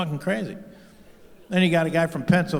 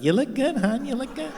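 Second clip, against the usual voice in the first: lightly voiced, higher mock reassuring wife; imitating Melania; 9 semitones higher than usual. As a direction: coo it sweetly to him. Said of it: "she's like".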